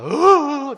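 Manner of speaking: loud, high imitation crying; mock sorrowful wail. Father imitates his small daughter bursting into tears, mock wailing. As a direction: wail like a crying child, hammed up. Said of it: "막 서럽게 우는 겁니다".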